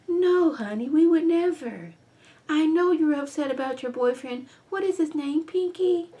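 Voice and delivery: high syrupy mock-sweet voice; sugary fake concern. [name] faking concern to Gail, high and syrupy. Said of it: "they come in".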